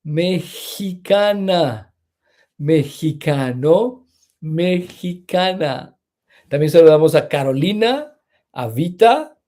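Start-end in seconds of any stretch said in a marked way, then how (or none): 0.0-6.0 slow teacher model. Shown as a demonstration. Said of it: "teacher modelling nationality endings".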